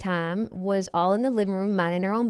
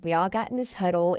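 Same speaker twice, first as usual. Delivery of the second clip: ordinary delivery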